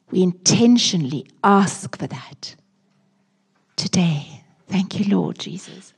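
hushed, slow earnest prayer to the Lord. Acted. Pastor praying for the congregation, hushed and earnest. Prays aloud in the sermon.

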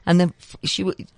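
normal talking voice

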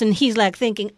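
ordinary talk, nothing marked

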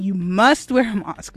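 normal talking voice

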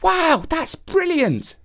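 put-on slow high amazed boy; awed little boy. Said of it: "said Jake".

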